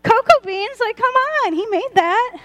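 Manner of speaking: preacher marvelling at cocoa beans; excited. Real, not acted. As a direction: gush about it. Excited about chocolate.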